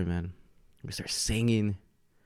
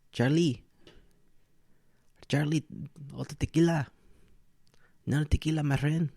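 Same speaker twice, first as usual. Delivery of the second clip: higher, hammed-up Mexican accent calling out; 6 semitones higher than usual. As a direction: call the bartender in a playful Mexican accent. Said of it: "in a Mexican accent".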